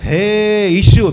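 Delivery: long, high, loud cry to Jesus; loud calling out. Acted. Dying thief calls to Jesus, loud calling out. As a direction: call out loudly, stretching the first word. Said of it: "હેનો મતલબ ઊંચા અવાજમાં બોલવું".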